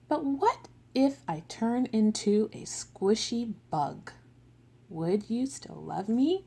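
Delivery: slow, gentle storybook read; picture-book reading with voiced characters; reading to children. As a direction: read it like a bedtime book for little kids.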